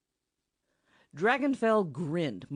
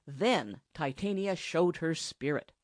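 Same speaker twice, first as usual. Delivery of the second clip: plain reading voice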